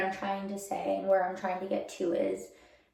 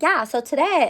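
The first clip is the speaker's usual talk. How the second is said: high, loud chirpy influencer; bubbly vlogger voice; 6 semitones higher, 8 dB louder than usual. Retold or imitated. Imitating makeup story-time girls.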